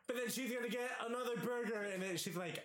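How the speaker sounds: normal talking voice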